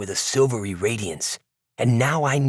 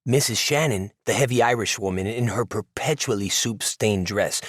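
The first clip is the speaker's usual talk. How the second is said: ordinary delivery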